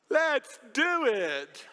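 loud, high, slow nervous blurt. Retold or imitated. Pastor at the podium, stuck, blurting it to the congregation. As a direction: blurt it out before thinking. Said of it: "and I go".

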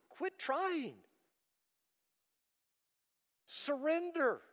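high, pleading preacher's cry, slowing on key words; impassioned altar-call plea; fervent preaching. Real, not acted. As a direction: urge them to surrender, full force. Preacher's climax, high and urgent.

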